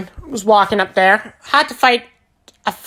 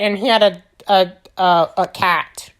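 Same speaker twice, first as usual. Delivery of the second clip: normal talking voice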